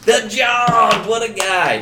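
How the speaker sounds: high, loud hype for a friend; real hyped-up praise; excited shout-out. Real, not acted. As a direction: cheer the name like a crowd favourite. Streamer celebrating the subscriber.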